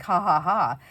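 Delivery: loud mock "ha ha ha"; loud, spelled-out fake laughter; imitated helpless laugh. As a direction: act out laughing you can't stop. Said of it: "I had one of those kind of laughs".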